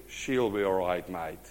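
lightly Aussie, dropping softly at the end; hint of easygoing Australian accent. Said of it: "a little bit of Aussie influence in him".

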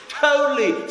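put-on drawn-out valley girl totally. Shown as a demonstration. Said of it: "say totally like a Cali-, like a valley girl".

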